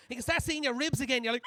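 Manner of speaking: normal talking voice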